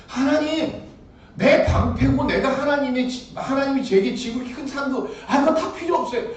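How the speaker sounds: loud, high, laughing complaint; exasperated loud protest; protesting to God. Acted. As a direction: throw Abraham's grievance at God, half laughing. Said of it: "하나님께 따져요".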